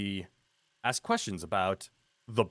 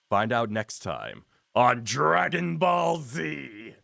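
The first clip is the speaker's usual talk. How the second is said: Dragon Ball announcer; slower than usual. Pretend. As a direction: boom it out like the show's narrator. Mock anime narrator sign-off.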